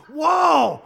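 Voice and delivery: high, very loud exclamation; startled reaction. Acted. Preacher reacting to grieving man's outburst, startled. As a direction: burst out as if taken aback.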